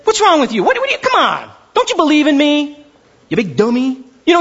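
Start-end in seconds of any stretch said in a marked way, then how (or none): 0.0-0.6 "What's wrong with you?" again higher and louder; louder mock scold. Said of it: "He doesn't slap him". 0.6-4.0 building hammed-up reprimand, rising higher; mock-scold a dim friend. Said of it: "He doesn't slap him".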